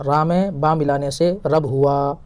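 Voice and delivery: "را میں با ملانے سے رب ہوا" read slowly and clearly. Shown as a demonstration. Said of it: "یہاں لکھا ہوا بھی ہے". (آہستہ اور صاف پڑھنا)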